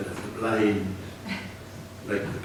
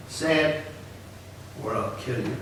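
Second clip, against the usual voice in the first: matter-of-fact; 7 dB quieter than usual. Acted. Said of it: "voice was very matter-of-fact now".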